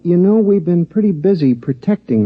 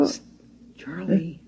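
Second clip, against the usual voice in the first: hushed call; 4 semitones higher, 10 dB quieter than usual. Acted. Mouse hisses from hiding.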